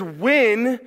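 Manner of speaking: when drawn out slowly and rising; stress on when. Shown as a demonstration. Pastor stressing "when" for the teens, slow and rising. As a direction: lean on "when", slow with a lift. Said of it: "It doesn't say if".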